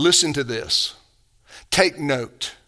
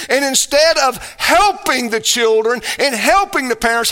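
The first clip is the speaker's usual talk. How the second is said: preaching at full force; 6 semitones higher than usual. Acted. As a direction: preach it, building fire. Preaching heats up.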